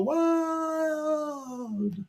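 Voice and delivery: high, long drawn-out crowd cheer. Acted. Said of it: "And the crowd would go".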